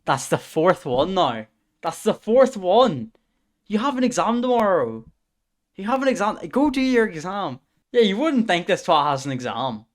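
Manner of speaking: telling off a friend in disbelief; exasperated scolding. Real, not acted. Streamer scolding Dylan who has an exam tomorrow, exasperated yell.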